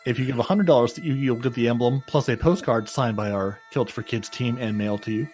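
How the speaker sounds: ordinary talk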